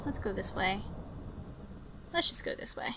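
sneaking whisper; tense real whisper. Real, not acted. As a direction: keep it hushed while sneaking.

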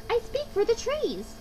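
high-pitched creature's proclamation; crying out as Lorax. A narrator's reading. Lorax speaking for the trees, crying out. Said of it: "cried the Lorax".

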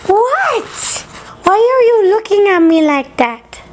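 high squeaky pet-talk voice. Acted.

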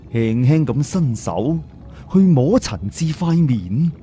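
ordinary delivery

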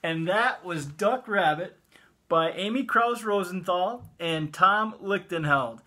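ordinary delivery, nothing marked

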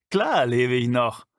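man back from the dark laughs off his friend's worry; laughing. A narrator's reading. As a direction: laugh it off while calling back. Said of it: "rief er lachend".

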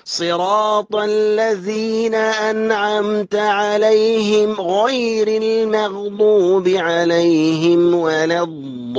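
slow sustained tajweed chant in long breaths; Quran recitation. Shown as a demonstration. Teacher models verse for students, slow tajweed chant. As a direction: recite slowly, holding the long vowels.

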